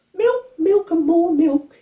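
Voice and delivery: put-on very high, slow baby voice; baby George complaining about milk. A narrator's reading. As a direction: grumble it like a fed-up baby. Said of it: "he said to Laura".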